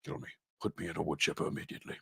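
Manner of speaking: low, quiet, fast deadpan plea; despairing doll voice. Retold or imitated. The doll begging to be destroyed, low quick deadpan. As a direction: mutter it like a doomed doll. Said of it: "The doll's just like".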